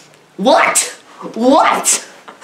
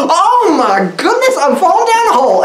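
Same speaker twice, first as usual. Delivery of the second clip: hammed-up panic; 7 semitones higher than usual. Voicing the actress falling.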